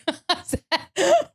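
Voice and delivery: high giggle at own slip; burst of laughter. Real, not acted. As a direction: crack up mid-story.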